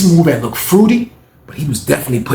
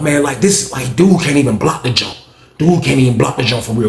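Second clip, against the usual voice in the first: ordinary talk, nothing marked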